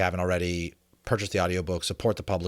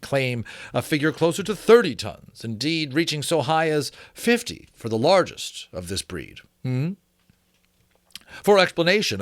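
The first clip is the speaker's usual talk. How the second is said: period treatise read a touch higher and fuller; formal reading; 3 semitones higher, 3 dB louder than usual. A narrator's reading. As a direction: read it like a learned 1790s author. Reads the old treatise.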